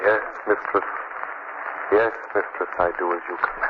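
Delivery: low, dazed obedient reply; a hypnotised man answering his mistress; David's voice. Acted. Mimic voices the enslaved fiancé answering the mistress, dull and obedient. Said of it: "that voice that answered Missus Nesbit was my fiancé".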